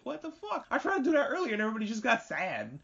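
high, baffled outburst; indignant what the. Retold or imitated. Louis reacting to Juno's speech, a high baffled outburst. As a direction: squeak it out in disbelief.

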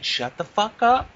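slow, high yelled shut the fuck up; mock yell. Finally snapping at the coworker, slow and yelled. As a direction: yell it out, dragging every word. Climax of retold rant.

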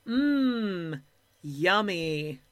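drawn-out mock "yum"; mock delight. Host scoffs at grapes served in dog bowl, mock delight. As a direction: feign being impressed by a sad snack.

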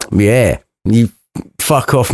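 ordinary talk, nothing marked

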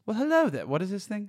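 high, loud mock Obi-Wan greeting; young Obi-Wan. Showing friend the Obi-Wan he wants, high and bright mock greeting. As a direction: chirp the greeting like Ewan. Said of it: "I wanna hear, like".

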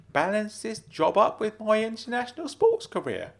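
high-pitched, animated uncle voice; Uncle Claude telling a joke. Acted. Uncle tells nephew a tennis joke, high and animated. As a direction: spin out the joke in a high character voice.

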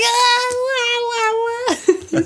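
high, hammed-up fake crying; mock wailing. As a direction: wail like a cartoon baby. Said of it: "I'm like".

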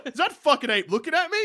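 mock threat at ape. Dusha picking a fight with an ape, hammed-up threat. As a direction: square up to the ape, slowing on the threat.

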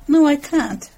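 narrated desperation, pushed a little; desperate. A narrator's reading. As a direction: blurt out a pained refusal while reading aloud.

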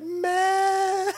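high loud yowl; cat scream. Retold or imitated. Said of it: "she's like".

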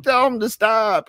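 put-on high, drawn-out childish whine; mock whiny plea. Storyteller as his upset young self to teacher, whiny plea. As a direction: plead slowly and high.

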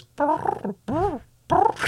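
high purring trill; cat trill. Retold or imitated. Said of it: "He goes".

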